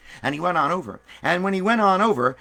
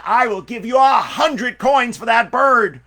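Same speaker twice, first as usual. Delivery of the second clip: high, loud and slow, almost shouting the offer; excited bidding; 7 semitones higher, 9 dB louder, slower than usual. Acted. Buyer in the crowd calling out his big offer, loud and eager. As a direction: outbid everyone with a big eager call.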